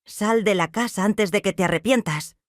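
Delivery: ordinary delivery, nothing marked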